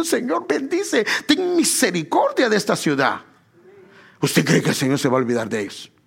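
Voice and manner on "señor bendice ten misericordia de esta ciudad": higher-pitched pleading, imitating a cried prayer; retold tearful prayer. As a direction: plead as if retelling your own tearful intercession. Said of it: "estaba llorando y diciendo".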